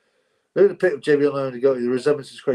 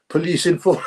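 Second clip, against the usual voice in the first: breaking into laughter; 5 semitones higher, 3 dB louder than usual. Real, not acted. Cracks up at the accusation.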